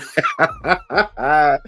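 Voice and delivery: high burst of laughter with a mouthful. Real, not acted. Laughing at a viewer's joke about bacon. Laughs while eating on stream.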